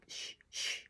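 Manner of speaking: breathy hushing shh; shushing whisper. A narrator's reading. Hatter and March Hare hushing Alice, whispered. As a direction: hush her in a whisper. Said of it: "the Hatter and the March Hare went".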